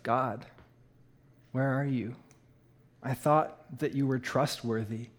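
low, hushed questioning of God; quiet grief. Retold or imitated. Bereaved son questions God after his dad's death, quietly grieving. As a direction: recall a wounded prayer, softly, with long pauses.

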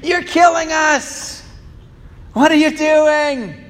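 put-on high, slow childish whine at God; complaining child. Preacher voicing humanity as a child complaining to God, high whine. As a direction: whine it like a hurt kid. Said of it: "from our point of view".

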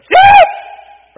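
huge high yell across the hills; shepherd calling out. Shown as a demonstration. Preacher demonstrating how the shepherd calls his lost sheep, huge high yell. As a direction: bellow it way up high and loud. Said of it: "the way I tell the story is".

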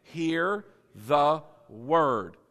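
"Hear the word" drawn out, each word separate; slow emphatic repetition. Shown as a demonstration. Preacher has everybody say it, slow and emphatic. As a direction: stretch "Hear the word" very slowly for the crowd. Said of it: "Say it again".

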